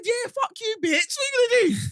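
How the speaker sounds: high-pitched, defiant retort; retold shouting back. Driver taunting the man in the van, shouting back. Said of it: "of course I'm shouting back".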